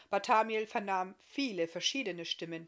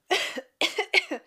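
sharp higher cough; 13 semitones higher, 12 dB louder than usual. Acted. Iska coughing before she goes on, short high cough. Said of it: "Sie hustete ein wenig".

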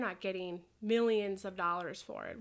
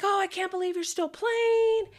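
gushing fan; 10 semitones higher, 7 dB louder than usual. Retold or imitated. Fans gushing at her.

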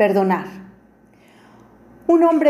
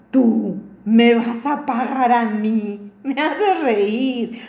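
mocking disbelief; much slower than usual. A narrator's reading. Rich man mocks begging thief.

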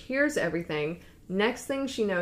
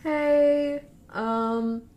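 higher, hesitant retold voice; awkward news-breaking; 5 semitones higher than usual. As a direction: ease into bad news, acting it out. Zola awkwardly breaks bad news.

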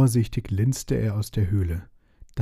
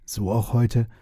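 ordinary delivery, nothing marked

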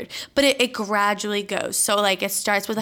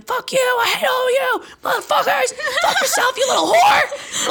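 very high mock scream; 4 dB louder than usual. Triggered aunt screaming at the whole family, furious mock scream. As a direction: imitate an aunt blowing up at everyone. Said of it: "screaming at everyone".

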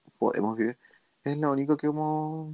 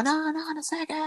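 mock yelling; 14 semitones higher, 3 dB quieter than usual. Retelling a Spanish woman yelling at a girl, high mock yell. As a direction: mimic a ranting woman in a high, held-back voice. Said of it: "le estaba gritando así como".

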